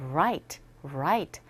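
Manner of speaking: "right" with a rounded English R; R sound. Shown as a demonstration. Teacher shows practised contrast with L, "right" on a rounded R. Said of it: "다른 발음을 구사할 수 있는".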